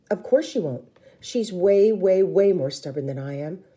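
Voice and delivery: ordinary delivery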